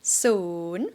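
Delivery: soon dips low then rises; Thai rising tone. Shown as a demonstration. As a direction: scoop soon down and up. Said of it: "let's count from zero".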